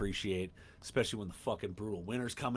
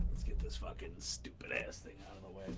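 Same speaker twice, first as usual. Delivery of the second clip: breathy whispered aside to himself; 2 semitones lower, 8 dB quieter than usual. Real, not acted. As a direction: whisper it like a sneaky confession. Muttering aside to himself on camera.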